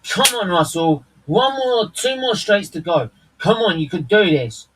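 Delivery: excited cheering. Real, not acted. Urging driver on live.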